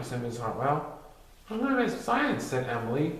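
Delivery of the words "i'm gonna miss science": put-on higher child voice; a small kid piping up. Emily telling classmates what she'll miss, higher.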